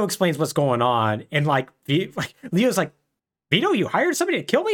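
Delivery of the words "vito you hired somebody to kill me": high, fast disbelief; incredulous. Retold or imitated. Podcaster voicing Leo learning of the hit, high incredulous.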